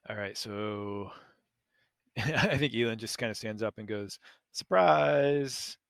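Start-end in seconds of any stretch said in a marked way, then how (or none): 4.5-5.8 drawn-out, raised 'surprise'; playful reveal, louder and higher; mock surprise. Elend pops up from behind the bar, mock surprise. Acting Elend popping up from hiding.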